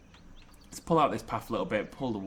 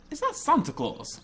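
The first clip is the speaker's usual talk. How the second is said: surprised. Real, not acted. Spots Santa in the park.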